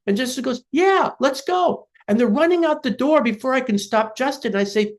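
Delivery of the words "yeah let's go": put-on high, drawn-out little-kid cheer; slow, high-pitched childlike enthusiasm; eager child. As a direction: imitate an excited four-year-old, pitched way up. Acted child agreeing to go digging.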